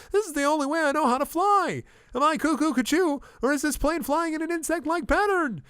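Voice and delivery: very high, loud frantic protest; frantic outburst. Acted. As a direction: shriek it in a cockpit panic. Frantic acted argument while flying.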